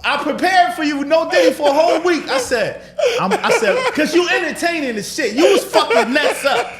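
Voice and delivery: laughing. Real, not acted. Laughing while recounting the battle.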